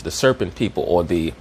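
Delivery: ordinary talk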